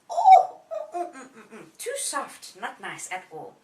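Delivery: lightly voiced girl, displeased; fussy little girl. Goldilocks tries soft bed.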